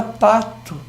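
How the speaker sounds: "pato" with final O said as U, "patu"; everyday pronunciation. Shown as a demonstration. Professor giving another example, "pato" ending in U. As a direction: end "pato" in a light U sound. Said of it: "Essa troca de O por U".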